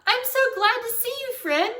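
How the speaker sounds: high, loud, overjoyed greeting; joyful shout. A narrator's reading. Girl tries to lift best friend, joyful shout. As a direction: shout it with pure delight. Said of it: "I shouted real joyful".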